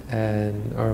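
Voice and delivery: ordinary talk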